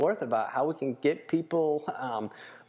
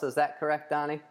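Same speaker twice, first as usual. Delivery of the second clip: ordinary delivery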